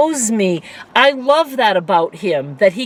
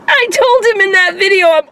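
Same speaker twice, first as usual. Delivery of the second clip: laughing; faster than usual. Real, not acted. Laughing while recalling.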